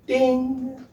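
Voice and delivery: high ringing "ding"; high-pitched bell sound, held; imitated ding. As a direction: chime it high like a little bell. Imitates a chime after boast.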